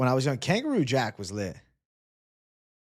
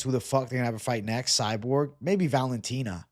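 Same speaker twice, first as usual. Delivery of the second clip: ordinary talk, nothing marked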